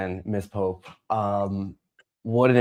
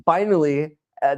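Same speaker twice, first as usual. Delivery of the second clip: usual speaking voice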